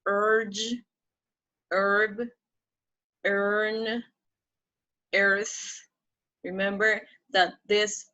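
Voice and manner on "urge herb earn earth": "urge, herb, earn, earth" each drawn out, long pauses between; stretched "er" vowel in "urge, herb, earn, earth"; model pronunciation. Shown as a demonstration. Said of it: "I'm going to be saying, pronouncing".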